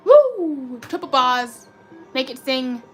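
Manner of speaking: ordinary talk, nothing marked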